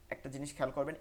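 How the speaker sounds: ordinary talk, nothing marked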